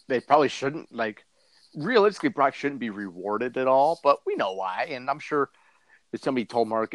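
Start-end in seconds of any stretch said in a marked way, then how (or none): none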